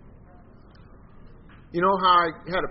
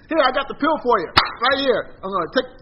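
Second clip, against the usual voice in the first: high, loud brush-off doctor voice; mock doctor who won't listen; hasty doctor; 8 semitones higher, 7 dB louder than usual. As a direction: play a careless doctor rushing you out. Mimics careless doctor.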